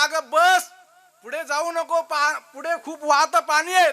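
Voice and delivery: husband pleading with wife in the river; frantic plea. Acted. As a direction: beg her to stop, scared. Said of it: "हा म्हणला".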